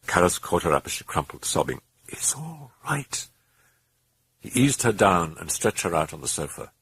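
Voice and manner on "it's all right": slow, soft whisper; a slow comforting whisper; soothing whisper. Acted. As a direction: hush her gently. Comforting sobbing Chris.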